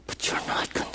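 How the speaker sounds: breathy whisper; whispered answer. Shown as a demonstration. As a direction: whisper it like a secret.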